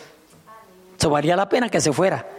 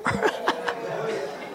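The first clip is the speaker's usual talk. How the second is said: breathy laugh; 8 dB quieter than usual. Real, not acted. Laughing at own sketch.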